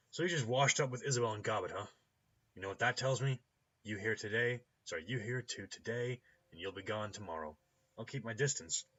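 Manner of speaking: loud, unimpressed gatekeeper voice; brusque doorkeeper. Acted. Doorkeeper questioning a stranger over the intercom, brusque and loud. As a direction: challenge the stranger loudly and curtly.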